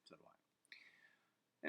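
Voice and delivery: slow, very soft whispered aside; hushed aside. Real, not acted. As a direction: drop to a breathy murmur. Aside after recalling King Arthur.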